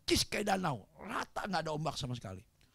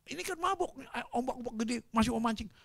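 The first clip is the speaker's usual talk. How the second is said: higher-pitched acted objection; retold complaint, pitched up; incredulous protest; 8 semitones higher, faster than usual. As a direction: protest at a crazy idea.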